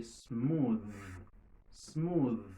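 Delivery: "smooth" drawn out on a buzzing th; voiced final th. Shown as a demonstration. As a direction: let the th of "smooth" hum at the end. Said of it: "les sonores". ("smooth" ak th sonò)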